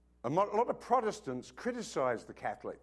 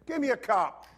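put-on demanding four-year-old; 8 semitones higher than usual. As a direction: play the cheeky kid bossing his dad. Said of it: "four-year-old said".

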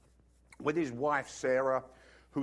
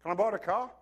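eager son; 5 semitones higher, 3 dB louder than usual. Retold or imitated. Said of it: "comes a little later and says".

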